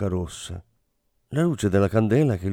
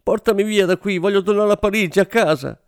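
high, louder, fast desperate cry; shrieked "Portami via da qui", much higher and louder; shrieking plea; 11 semitones higher, 4 dB louder than usual. A narrator's reading. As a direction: beg to be taken home in panic. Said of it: "strillò".

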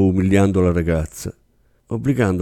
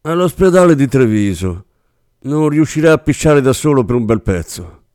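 hard, raised and cold; harsh; 6 semitones higher, 5 dB louder than usual. Acted. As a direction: slam the news down on them. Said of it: "rispose Bressan con durezza". (risposta dura e spietata)

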